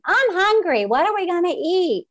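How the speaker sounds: mother imitating hungry children, high whiny mock complaint; whiny hungry kids. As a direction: mimic the kids nagging for food. Mom voicing her demanding kids.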